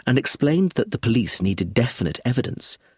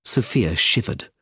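ordinary delivery

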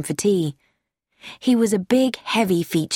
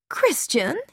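surprised; 6 semitones higher than usual. A narrator's reading. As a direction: catch the name as unexpected news. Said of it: "Carrie Louise looked surprised".

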